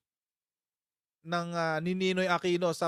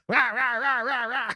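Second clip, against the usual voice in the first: high, loud "ra-ra" babble; mock ranting noise; 7 semitones higher, 3 dB louder than usual. Host impersonating offended critics, mock ranting. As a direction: imitate angry critics as wordless yapping. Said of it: "Mag-impersonate nga tayo".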